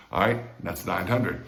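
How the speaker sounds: ordinary talk, nothing marked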